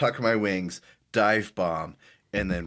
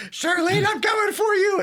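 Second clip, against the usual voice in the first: very high, loud charging shout; bird's battle cry; 7 dB louder than usual. Acted. Flappy diving in for Charlene, high battle shout. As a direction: scream it like a hammed-up dive-bombing bird. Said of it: "Flappy's gonna say".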